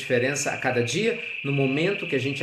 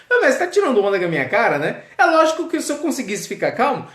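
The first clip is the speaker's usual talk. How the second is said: muito mais agudo e alto, nervoso e rápido; discípulo nervoso; 12 semitones higher, 6 dB louder than usual. Acted. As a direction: exploda de nervoso explicando. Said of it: "ele ficou nervoso pra explicar que tava nervoso". (discípulo reclamando ao mestre, muito agudo e nervoso)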